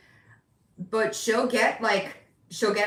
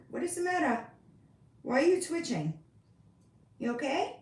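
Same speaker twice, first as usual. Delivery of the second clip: pet talk; 7 semitones higher, faster than usual. Real, not acted. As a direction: fuss gently over the cat. Talking to the cat.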